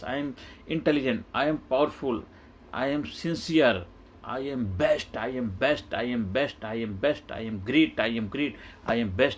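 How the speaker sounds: slow, chanted affirmations, each line in its own breath; positive affirmations. Shown as a demonstration. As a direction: lead listeners through self-affirmations, slowly and steadily. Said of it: "Positive affirmation".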